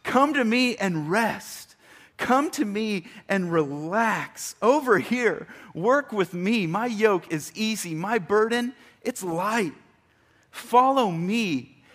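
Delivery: a preacher voicing Jesus, beckoning warmly but a little lightly; calling invitation.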